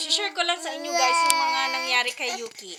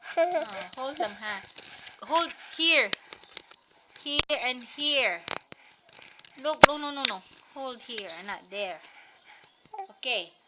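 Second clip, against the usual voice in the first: ordinary talk, nothing marked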